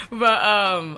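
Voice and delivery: usual speaking voice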